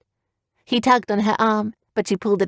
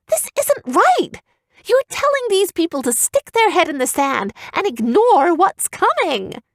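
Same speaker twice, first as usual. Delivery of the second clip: put-on much higher girl's angry cry; erupting girl; 10 semitones higher than usual. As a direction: explode at him in a high voice. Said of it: "Evian erupted".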